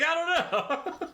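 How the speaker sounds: very high, slow, helpless "I don't know"; slow high-pitched shrug of a reply; clueless squeak. Retold or imitated. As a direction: play him baffled and meek.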